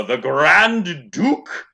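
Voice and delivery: loud, high, slow fanfare delivery; grand title announcement. Acted. Game master honoring a commenter, grand loud proclamation. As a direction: proclaim the title with drawn-out pomp.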